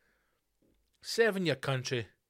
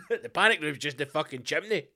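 ordinary talk

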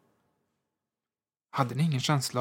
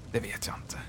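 hushed, voiceless whisper; 2 semitones lower, 8 dB quieter than usual. A narrator's reading. Signalman whispering his fear to the visitor. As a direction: breathe it out so no one else hears. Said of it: "talade så lågt att det knappast var mer än en viskning".

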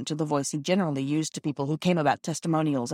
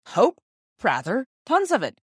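bright, lifted "Hope?" tossed out louder; cheerful; 6 semitones higher, 4 dB louder than usual. Acted. Father cheering up his worried son, cheerful and loud. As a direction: brush off the worry with a cheerful laugh in the voice. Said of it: "said Father cheerfully".

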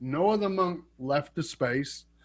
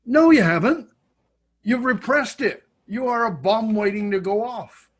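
emphatic loud retort; 7 semitones higher, 4 dB louder than usual. Acted. Retorting to imagined Western students.

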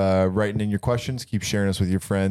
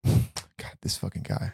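muttered under breath; 4 dB quieter, slower than usual. Real, not acted. Exasperated aside under breath.

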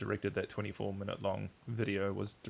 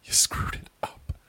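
whispered aside; 6 dB louder than usual. Real, not acted. Telling the cohost he botched the intro, whispered. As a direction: hiss it under your breath.

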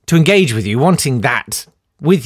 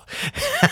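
high laugh; 7 dB quieter than usual. Real, not acted. Laughing at near drowning.

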